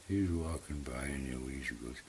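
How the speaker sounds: ordinary delivery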